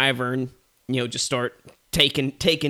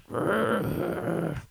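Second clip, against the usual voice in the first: mock bear roar; 5 semitones higher, 4 dB quieter than usual. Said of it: "and he's like".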